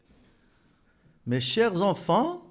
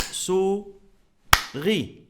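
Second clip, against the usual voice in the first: "Souris" as sou, long pause, ri; sou... ri for "Souris", clapping-drill style; tapped-out syllables; 3 semitones higher than usual. Shown as a demonstration. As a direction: beat out "Souris" in two separate syllables. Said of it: "Tapez avec moi".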